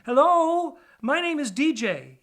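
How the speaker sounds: high, loud squeaky rat hello; eager little rat greeting. Acted. DJ calls out to a big city rat, high and loud. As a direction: call out brightly to a stranger.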